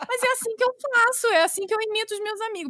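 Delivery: high-pitched, mock-indignant protest; indignant retort. Wife defends her voice to husband, mock-indignant. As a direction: protest in a squeal, hamming up the indignation.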